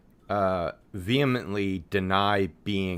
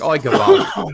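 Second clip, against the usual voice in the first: loud, raised Yiddish-flavoured Oi, Gavalt; mock exclamation; 6 semitones higher, 8 dB louder, slower than usual. Joking about saying oi, loud mock Yiddish cry. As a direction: throw out Oi, Gavalt like an old uncle.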